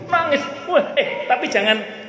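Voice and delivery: high-pitched, amused outburst while retelling; laughing exclamation. Retold or imitated. Preacher joking about his funeral speech, high laughing outburst. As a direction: burst out high, still amused.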